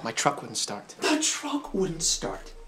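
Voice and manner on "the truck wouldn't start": high, drawn-out mocking disbelief; sarcastic echo. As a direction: stretch out THE TRUCK, dripping with suspicion.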